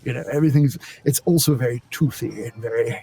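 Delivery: toothy Queen's English accent; characterized British royal accent. Shown as a demonstration. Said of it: "to do the Queens".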